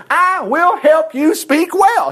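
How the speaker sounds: slow, high, ringing declaration; forceful drawn-out promise; emphatic Lord. Acted. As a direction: proclaim it one word at a time. Voices the Lord's firm promise.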